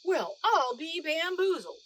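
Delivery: high, loud astonished exclamation; the guard stunned by the prize; amazed guard. A narrator's reading. Guard hearing the artists cheer, loud and amazed. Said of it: "said the guard".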